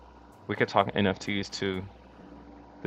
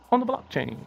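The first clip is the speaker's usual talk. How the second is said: "On the blockchain" jumped up high and hyped; hyped echo. Shown as a demonstration. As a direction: repeat "On the blockchain" in a high excited squeal. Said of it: "repeat with emphasis".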